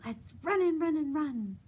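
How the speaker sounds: high, loud and fast; excited pumpkin voice. Acted. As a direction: rush it out high and eager. Pumpkin voice in finger play.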